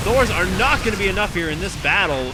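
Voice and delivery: loud, high shouting play-by-play; hype battle call. Real, not acted. Caster calling the Baneling explosions, loud high shout. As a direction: yell it as the fight breaks.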